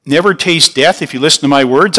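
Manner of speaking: ordinary delivery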